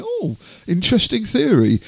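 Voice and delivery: high-pitched courteous humouring; feigned polite interest. Retold or imitated. Interviewer humouring the singer's crop circle theory, feigned interest. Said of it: "I'm trying to be polite".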